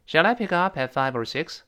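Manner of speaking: 'six' lifted at the end of the question; rising question ending. Shown as a demonstration. Teacher modelling a general question, rising tune up to 'six'. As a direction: link 'five or' lightly and rise on 'six'. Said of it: "最后是扬起来声音".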